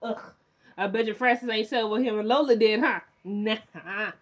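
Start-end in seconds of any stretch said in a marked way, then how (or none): 0.0-0.2 much softer, fed-up groan at the name; disgusted groan. Retold or imitated. Fan groaning at Francis on screen, disgusted. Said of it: "I'm like".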